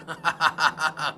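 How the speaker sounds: short chuckling burst; helpless little run of laughs; real laughter. Real, not acted. Commentator amused by a driver punted by his router, real chuckle. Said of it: "Oh man, I'm laughing".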